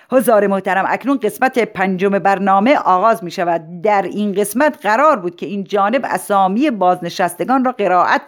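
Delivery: ceremonial programme host; formal announcer. Acted. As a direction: announce it to a hall, projecting firmly. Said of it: "چنین اعلام شد". (اعلام رسمی مجری مراسم)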